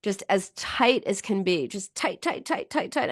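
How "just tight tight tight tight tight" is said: tensed, softer strained words; straining. Real, not acted. A therapist tensing her whole body with listeners, straining. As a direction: squeeze out the words while clenching every muscle. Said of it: "I'm doing it right now".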